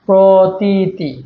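"Pratiti" very slow, long vowels held; slow sounding-out. Shown as a demonstration. Teacher pointing out the spelling, "Pratiti" slowly stretched by syllable. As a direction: draw out each syllable of "Pratiti". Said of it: "ভুল কোনটা?".

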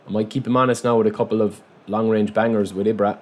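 usual speaking voice

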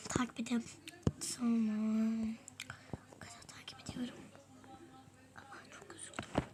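very soft whisper; barely whispered, hiding. Real, not acted.

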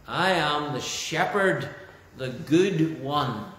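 slow, with "the good one" stretched and stressed; emphasised. Shown as a demonstration. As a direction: give "good" the full weight, drawn out. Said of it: "an important emphasis".

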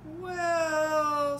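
a loud, high "Well" on a long breath; drawn long breath. A narrator's reading. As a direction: let the breath out heavily before you speak. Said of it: "fetching a long breath".

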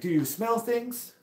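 put-on high, slow French-accented question; puzzling imitation French accent, drawn out. French rider testing the injured biker, imitated French accent. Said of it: "this French guy".

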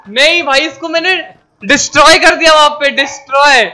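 high, loud gloating yells; excited shouting. Real, not acted. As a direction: crow over the kill, loud and high. Brags loudly about the kill.

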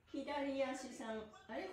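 ordinary delivery

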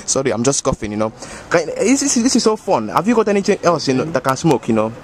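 put-on thick West African accent, easygoing; African uncle accent. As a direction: play a boastful African uncle. Same character voice.

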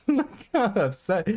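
high-pitched, laughing mockery. Real, not acted. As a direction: say it through a fit of giggles. Laughing at upset opponent.

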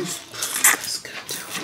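whispering. Real, not acted. Whispered aside at dinner.

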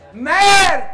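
loud, high exclaimed French swear; shouted curse. Acted. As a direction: bellow the punchline. Punchline French good-luck curse.

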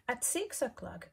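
ordinary delivery, nothing marked

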